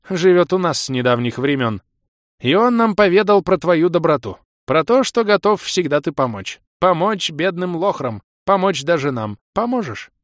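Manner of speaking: put-on high, loud singsong old creature. A narrator's reading.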